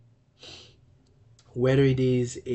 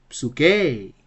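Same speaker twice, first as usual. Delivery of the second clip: "psuke" as psu-KAY, final syllable raised and loud; accent on last syllable; 3 semitones higher, 9 dB louder than usual. Shown as a demonstration. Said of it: "So the pronunciation would be".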